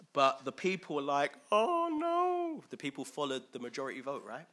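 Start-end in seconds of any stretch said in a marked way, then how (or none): 1.5-2.6 high, loud mock whine; a comic wail of refusal; hammed-up dread. The people refusing Caleb and Joshua, mock-panicked whine.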